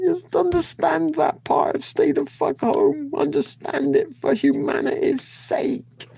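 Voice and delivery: very high, loud furious scolding; a shrill, enraged voice lecturing a viewer about staying home; shrieking rant. Acted. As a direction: screech at her to stay home. Ranting at Judy to stay home.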